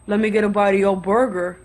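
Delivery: low-voiced guy making a demand; imitated gruff demand. Man repeats his demand.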